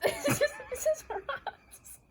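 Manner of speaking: high, slow words through giggles; real laughter through the words; laughing. Real, not acted. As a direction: crack up mid-line. Laughing blooper.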